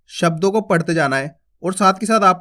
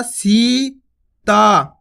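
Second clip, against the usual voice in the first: Sita stretched very slowly; drawn out; much slower than usual. Shown as a demonstration. Teacher drawing the word out for children. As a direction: hold each vowel of Sita long. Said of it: "खींच के उच्चारण करना".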